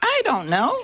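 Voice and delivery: high-pitched little squirrel. A narrator's reading. Squirrel answering the deer about the crocodile, high-voiced. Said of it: "said a squirrel".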